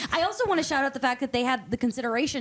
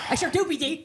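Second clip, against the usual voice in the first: higher, put-on wistful reply; mock nostalgic voice; 5 semitones higher than usual. Cohost echoes his own agreement in a higher mock voice. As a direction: repeat it in a hammed-up voice.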